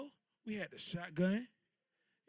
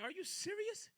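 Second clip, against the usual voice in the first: shocked disbelief; 13 semitones higher, 5 dB quieter than usual. Retold or imitated. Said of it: "I'm like".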